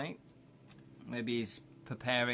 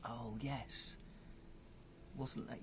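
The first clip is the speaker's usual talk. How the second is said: ordinary delivery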